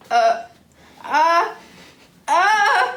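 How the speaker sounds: rising uh, uh, then a loud achoo; sneeze. Acted. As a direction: build up and explode into a big sneeze. Sneeze building up.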